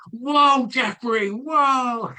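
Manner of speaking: high, loud panicky "Whoa, Jeffrey"; high shrieking whoa at a runaway horse; panicked whoa. Shown as a demonstration. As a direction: yelp it like the horse is bolting. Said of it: "rather than going".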